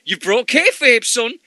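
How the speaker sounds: high, playful scolding voice; wrestler scolding. Retold or imitated. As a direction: tell him off like a grumpy wrestler. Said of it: "And he's like".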